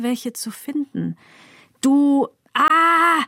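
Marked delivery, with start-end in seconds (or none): 1.8-3.2 loud, high, drawn-out searching; straining for insults. A narrator's reading. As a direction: stall loudly while hunting for a curse. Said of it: "Angestrengt denke ich an Schimpfwörter, finde es schwer". (laut und angestrengt nach Worten suchend)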